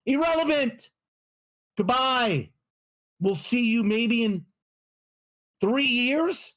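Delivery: high, punchy put-down of the word; emphatic dismissal. Real, not acted. Taunting Patriots fans, high emphatic put-down. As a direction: slam the word like a verdict. Mocking rival team's fans.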